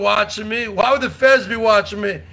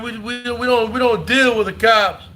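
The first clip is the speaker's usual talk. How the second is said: ordinary delivery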